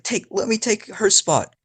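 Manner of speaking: high, pleading, acted; mock crying. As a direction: beg in a put-on tearful pitch. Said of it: "I'm crying on the background".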